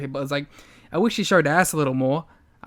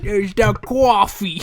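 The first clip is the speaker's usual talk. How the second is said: mock New York accent; 2 semitones higher, slower than usual. Co-host mocks the wrestler's attempt, in a hammed-up New York accent. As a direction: ham up a bad New York coffee. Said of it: "a New York accent".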